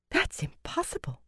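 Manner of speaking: breathless whisper; startled whisper. A narrator's reading. Eldrith reacts to hidden weaves, whispering. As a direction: gasp it out under breath.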